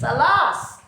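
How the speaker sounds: a touch of hammed-up sorrow on Alas; mock lament. Shown as a demonstration. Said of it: "it gives you a sad feeling".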